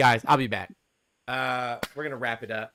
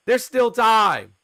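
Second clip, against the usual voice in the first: high, loud and slow; rally the viewers hopefully; excited; 11 semitones higher, 13 dB louder, much slower than usual. Real, not acted. Urging viewers to hit the goal.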